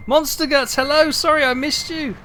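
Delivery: high, loud, excited shout-out; excited greeting. Real, not acted. Streamer greeting a viewer they missed, excited and loud. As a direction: call out a delighted hello.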